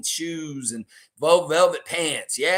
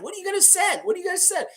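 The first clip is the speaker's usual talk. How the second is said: much higher, puzzled cartoon creature; squeaky raptor voice; much faster than usual. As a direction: squeak the question like a baffled little dino. Raptor confused by driver.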